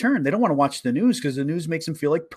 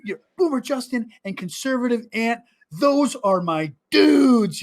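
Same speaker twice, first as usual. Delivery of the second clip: fangirling; 6 semitones higher than usual. Retold or imitated. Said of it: "she's like".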